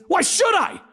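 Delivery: high-pitched, petulant comeback; mock-outraged question; defiant retort. A narrator's reading. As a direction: push back indignantly, pitch jumping up. Acted defiant retort when told to stop.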